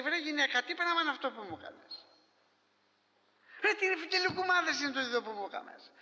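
the husband fed up with the dish; exasperated husband. Retold or imitated. Husband complains about the dish. (ο φουκαράς σύζυγος αγανακτεί με τη γυναίκα του, πιο ψηλά)

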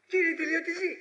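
high-pitched baffled question; acted bafflement. Acted baffled questioning about Paul's claim.

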